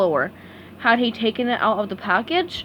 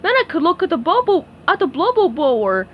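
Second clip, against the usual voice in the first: Patrick voice; 6 semitones higher, 4 dB louder than usual. A narrator's reading. Patrick fumbling the word.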